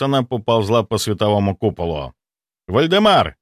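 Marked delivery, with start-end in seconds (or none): 2.7-3.4 loud call across the battle; calling shout. A narrator's reading. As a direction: shout the name to get attention. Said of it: "крикнул я".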